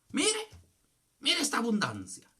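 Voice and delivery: high, loud showing off; loud, high-pitched mock boast; imitated boasting. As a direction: imitate a pastor flaunting his plenty. Acting out a boasting pastor.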